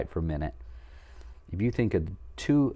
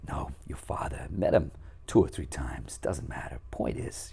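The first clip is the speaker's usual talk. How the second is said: Christopher Walken impression; 6 semitones lower than usual. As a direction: lightly imitate a famous actor's halting speech. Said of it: "you know, Christopher Walken".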